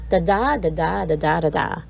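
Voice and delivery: Da da with the second da stressed, rising then falling; iambic beat demo. Shown as a demonstration. Teacher showing students how iambic pentameter sounds. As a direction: tap out the iambic rhythm. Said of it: "and it goes like this".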